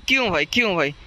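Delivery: normal talking voice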